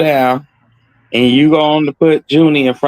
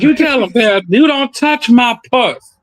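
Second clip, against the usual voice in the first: granny's voice; 10 semitones higher than usual. Retold or imitated. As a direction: warn sternly like an old granny. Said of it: "She said".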